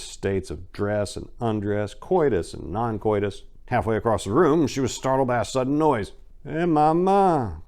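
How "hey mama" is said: slow, higher drawn-out call from the couch; lazy call. Acted. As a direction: stretch out mama, too wasted to move.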